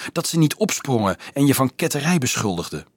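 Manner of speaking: ordinary delivery, nothing marked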